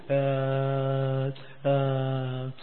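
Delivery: long, low, level hesitation noises; reluctant stalling. Acted. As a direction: hem on the phone, avoiding an answer. Reluctant member stalling on phone.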